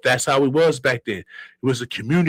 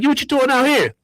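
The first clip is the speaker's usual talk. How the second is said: hyped street greeting; 9 semitones higher, 3 dB louder than usual. Retold or imitated. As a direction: call it out like spotting a friend on the block. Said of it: "So he's like".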